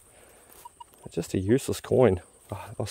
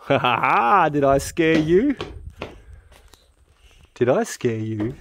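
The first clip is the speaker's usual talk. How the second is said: gloating laugh; 4 semitones higher, slower than usual. Real, not acted. Gloating after the scare.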